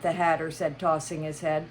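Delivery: ordinary delivery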